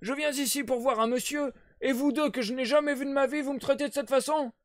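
put-on old sailor protesting at being blocked; indignant cry. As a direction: cry out in protest, stick on the floor. Old man keeps protesting.

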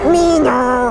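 high growled monster shout; Animal impression. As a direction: bark it like a wild Muppet. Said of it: "remember Animal from, uh, Muppet Babies?".

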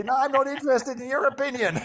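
high, fast comic retort; mock indignant refusal. Meditator refusing the mind's opinion, in hammed-up indignation. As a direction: play an exasperated brush-off, pitched well up. Acted quarrel with the chattering mind.

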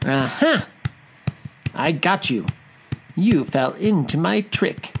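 lightly triumphant mouse deer; spring the trap with a touch of glee; mild storybook gotcha; exclaiming. A narrator's reading. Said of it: "exclaimed Mouse Deer".

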